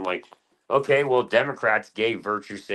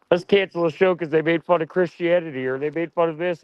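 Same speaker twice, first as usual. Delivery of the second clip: mock outraged censor; 7 semitones higher, 3 dB louder than usual. Mocking people who cancel shows.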